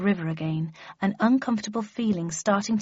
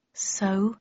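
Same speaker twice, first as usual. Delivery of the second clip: slowly. A narrator's reading. Daughter slowly asking her father, a near whisper. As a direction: let it hang, half whispered. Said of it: "I answered slowly".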